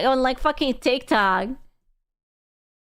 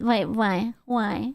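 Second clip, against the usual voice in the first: ordinary talk, nothing marked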